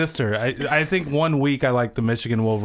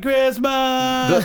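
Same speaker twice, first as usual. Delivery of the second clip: high, drawn-out holiday cheer; hollered greeting; 13 semitones higher than usual. Retold or imitated. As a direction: holler it high and long like greeting a crowded bar. Calling out greeting to friends.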